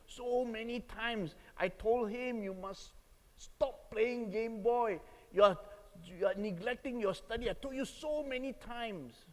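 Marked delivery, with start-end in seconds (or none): 2.4-9.3 higher-pitched exasperated father's scolding; acted-out parental telling-off; retold angry scolding. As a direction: scold a disobedient son, reenacting it. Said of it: "I was very angry with my son".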